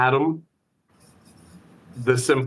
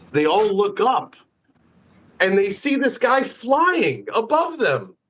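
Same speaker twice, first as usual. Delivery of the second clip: normal talking voice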